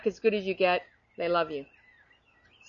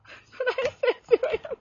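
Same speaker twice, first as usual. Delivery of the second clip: laughing. Real, not acted. Laughing after her punchline.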